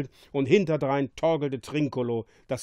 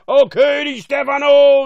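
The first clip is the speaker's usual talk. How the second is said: piercing high cry of joy; gleeful yell to the king; jubilant shriek; 12 semitones higher, 17 dB louder than usual. A narrator's reading. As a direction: shout it out, high and very loud. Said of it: "stieß einen gellenden Jubelschrei aus".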